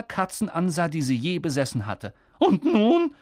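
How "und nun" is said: high, loud angry question; higher, louder annoyed aunt. A narrator's reading. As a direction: snap it out in annoyance. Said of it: "so zornig".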